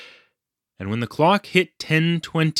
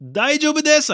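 high, alarmed blurt; gasped reply; 3 dB louder, faster than usual. A narrator's reading. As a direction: blurt it out in a high-pitched panic. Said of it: "I gasped".

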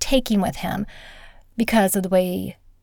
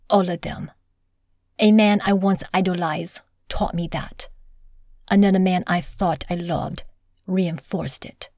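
dramatic reading; 2 semitones lower, 3 dB quieter than usual. Acted. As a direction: read it like a book trailer. Reads the book blurb aloud.

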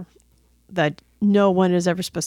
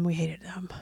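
soft, almost voiceless aside; hushed confession; 2 semitones lower, 10 dB quieter than usual. Real, not acted. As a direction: drop to a near whisper. Mock-dramatic list about the wigs.

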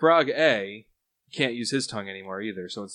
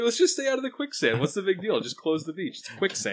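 mock official; 7 semitones higher than usual. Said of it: "It was just like".

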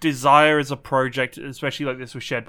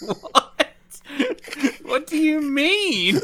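laughing; 11 semitones higher, much slower than usual. Real, not acted. Confused at odd remark.

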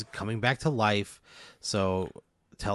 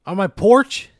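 loud, high incredulous question; appalled disbelief; 8 semitones higher, 11 dB louder, slower than usual. Acted. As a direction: react in outraged astonishment. Told of suicide on his porch.

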